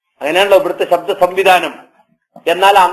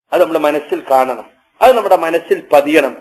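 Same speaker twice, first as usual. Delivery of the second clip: usual speaking voice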